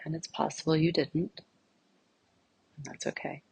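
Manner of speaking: soft, unhurried meditation voice with long pauses; guided meditation. Real, not acted. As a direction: gently guide listeners inward, leaving silence between phrases.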